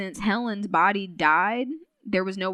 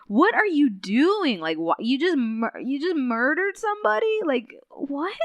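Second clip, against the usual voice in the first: hurt disbelief; 6 semitones higher, 4 dB louder than usual. Retold or imitated. Carl confronting the bad guy over a murder, hurt and incredulous. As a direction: act out wounded, incredulous questioning, pitch climbing. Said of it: "Carl is so hurt".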